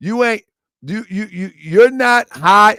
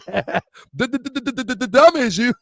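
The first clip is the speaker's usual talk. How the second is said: imitating a stutter; 6 semitones higher than usual. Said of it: "my uncle stutter".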